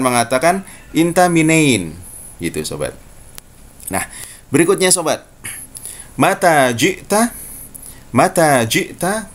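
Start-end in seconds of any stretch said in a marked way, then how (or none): 6.2-9.3 slow Fusha "mata jikta"; formal Arabic. Shown as a demonstration. Teacher modelling standard Arabic for students. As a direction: say "mata jikta" slowly and clearly. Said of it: "kapan kamu datang".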